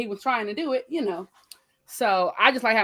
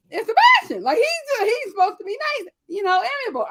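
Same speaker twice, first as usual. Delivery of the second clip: high-pitched protest; 13 semitones higher, 5 dB louder than usual. Real, not acted. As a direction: voice shoots up in comic objection. Mock protest over beloved character.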